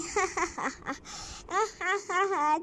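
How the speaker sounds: high villain cackle; evil laugh. Acted. Kidnapper gloating over the captive girl, evil laugh.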